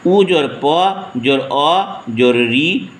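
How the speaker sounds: slow, letter by letter, जोर between each sound; spelled out slowly. Shown as a demonstration. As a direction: dictate every letter apart for pupils. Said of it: "हाँ, उपरिष्टात है".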